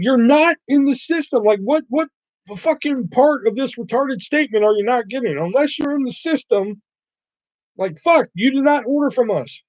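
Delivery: exasperated arguing. Retold or imitated. Pizza worker retelling an argument with a scamming caller, exasperated. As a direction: argue it as if to someone refusing to get it.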